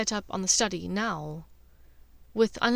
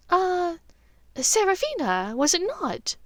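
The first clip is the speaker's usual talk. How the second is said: higher, rising, careful answer; nervous Narcissa; 9 semitones higher than usual. Acted. Disguised Draco guesses the bird's name, high and tentative. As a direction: lift it up, sweating the guess.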